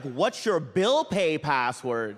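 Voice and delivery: slow, dramatic, drawn-out question; ominous slow question. Retold or imitated. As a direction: drag the question out like a threat. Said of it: "Then he's like".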